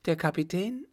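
high, alarmed question; startled question. A narrator's reading. Woman learning her brother was outside, startled question. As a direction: ask it in sudden alarm, pitch jumping up. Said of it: "fragte sie erschrocken".

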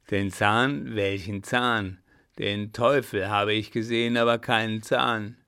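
loud, heavy indignant denial; angry denial. A narrator's reading. Clown rejects the rope dancer's claim, angry and loud. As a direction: deny it with force. Clown angrily denies seeing it.

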